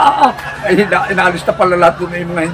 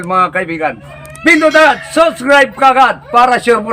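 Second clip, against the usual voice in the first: lively, higher channel-host voice with slow plugs; vlog host intro; 6 semitones higher, slower than usual. Acted. Host greeting viewers and asking them to subscribe, upbeat.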